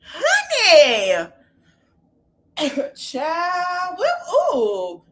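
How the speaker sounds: high, loud exclaimed going at it; dramatic reaction. Real, not acted. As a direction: gasp at the drama. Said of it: "I need to get my hair growth oil".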